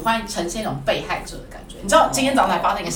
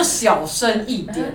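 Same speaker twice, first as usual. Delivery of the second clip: slow warning; much slower than usual. Retold or imitated. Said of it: "我说".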